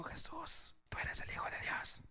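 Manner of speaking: the wrong way, a soft whisper. Shown as a demonstration. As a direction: breathe it out in a whisper. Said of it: "no lo dijo quedito".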